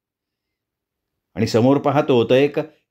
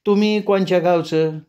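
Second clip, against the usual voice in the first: much higher, rising on the first word; eerie village woman; 5 semitones higher than usual. Acted. The woman answers the traveller, high and strangely calm. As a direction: speak with an odd calm.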